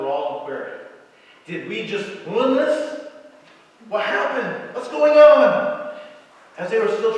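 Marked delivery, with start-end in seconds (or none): 1.5-5.9 rising alarm, ending in a loud, slow cry; panicked confusion building to a shout; three salesmen suddenly unsure the deal is lost; bewildered salesmen. Acted. Confused salesmen voiced in story.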